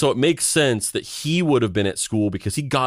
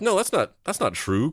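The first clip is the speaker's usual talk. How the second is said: high-pitched parental protest, retold; 8 semitones higher than usual. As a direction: object at once, voice jumping up. Parents object to son's claim.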